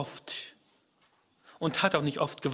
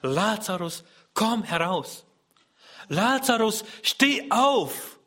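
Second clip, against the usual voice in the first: building to a higher, louder call; 6 semitones higher, 4 dB louder, slower than usual. Acted. Jesus calling dead Lazarus from the tomb, loud call. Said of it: "rief er mit lauter Stimme".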